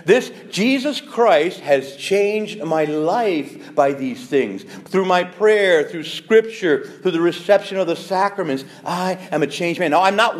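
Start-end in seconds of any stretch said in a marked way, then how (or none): none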